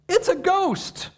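high, slow frightened shriek; terrified cry. Acted. Disciples seeing a figure on the lake, high frightened cry. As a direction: squeal it like a scared disciple. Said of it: "they were terrified".

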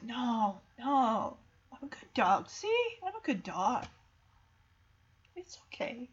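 put-on soft, soothing voice for a frightened child; gentle reassurance. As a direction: imitate a kind dog calming her, dropping to a hush. Dog calms frightened girl in story.

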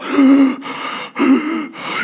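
exaggerated sobbing breaths; hammed-up weepy gasps; mock emotional gasps. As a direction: imitate someone overcome, gasping for air. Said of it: "they get very emotional".

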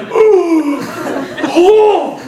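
high, loud, laughing gasp as the scotch burns; choked gasp. Retold or imitated. Newcomer gasping after downing the scotch, laughing. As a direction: gasp through the burn of the drink. Burning throat after the shot.

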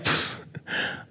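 soft, voiceless puff of scorn; dismissive pfft. Retold or imitated. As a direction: scoff with a quick puff of air. Acts out scoffing at the rule.

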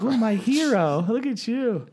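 high, slow mock female gushing; adoring girlfriend. Girlfriend praises her superhero on the plane, adoring. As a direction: swoon over him in a put-on high voice. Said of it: "She was like".

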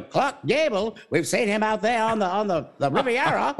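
a playful hint of forties Mid-Atlantic; Mid-Atlantic accent. Host jokingly imitating old Hollywood in Mid-Atlantic accent. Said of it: "it's called the mid or transatlantic accent".

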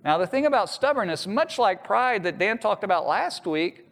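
ordinary talk, nothing marked

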